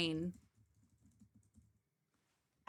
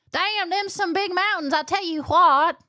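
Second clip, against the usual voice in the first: mock Southern accent; 9 semitones higher, 4 dB louder than usual. After praising the Appalachian accent.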